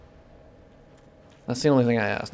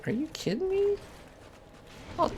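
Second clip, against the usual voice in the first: much higher, softer, strained disbelief; incredulous squeal; 8 dB quieter than usual. Real, not acted. Gamer squeals at opponent's nasty toxic attack, high disbelief. Player reacts to a nasty hit.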